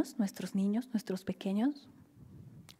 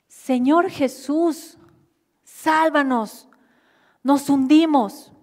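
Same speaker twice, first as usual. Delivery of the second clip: loud, higher, slow plea; desperate cry for help; 6 semitones higher, 13 dB louder, slower than usual. Retold or imitated. Disciples begging Jesus to save them, crying out. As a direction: cry out as the boat sinks. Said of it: "los discípulos gritaron".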